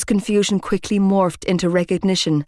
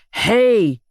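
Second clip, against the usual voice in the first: Shane roaring; 3 semitones higher than usual. A narrator's reading. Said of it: "Shane roared".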